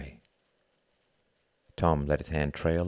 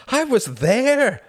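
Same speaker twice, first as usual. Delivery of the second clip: drawn-out, high, loud cry; despairing wail; 7 dB louder, much slower than usual. A narrator's reading. Hart protesting to Tom that he failed, wailing. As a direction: wail it slowly at full pitch. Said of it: "wailed Hart in despair".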